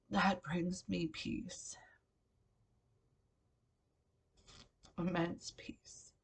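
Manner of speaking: slow, tearful whisper. Real, not acted. Vlogger whispering about her late brother, tearful. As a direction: breathe the words out through tears.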